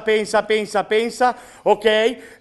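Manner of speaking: ordinary talk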